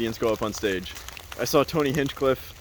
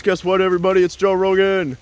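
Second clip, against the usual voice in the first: loud, high hype-man announcement; excited club MC intro; mic announcer; 6 semitones higher, 6 dB louder than usual. Retold or imitated. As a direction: belt it out like introducing a surprise headliner. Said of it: "the mic announcer guy gets up there".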